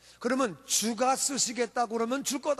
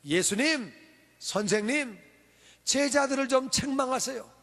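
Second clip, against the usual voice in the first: ordinary talk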